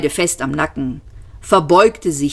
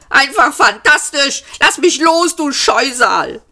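high, furious struggling yell; exasperated high-pitched cry; blurted outburst; 9 semitones higher than usual. A narrator's reading. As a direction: burst out sarcastically, then shout to be released. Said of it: "stieß Arthur hervor".

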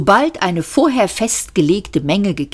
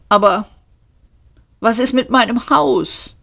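higher-pitched, plaintive question; 6 semitones higher than usual. A narrator's reading. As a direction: ask it pitifully, like a helpless victim. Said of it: "fragte er kläglich".

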